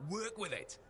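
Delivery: mock yell in the middle of a story; retold yell. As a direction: call it out high, holding back the volume. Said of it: "so I yell".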